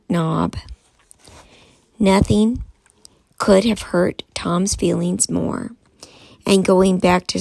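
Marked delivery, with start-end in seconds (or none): none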